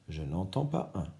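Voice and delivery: ordinary talk, nothing marked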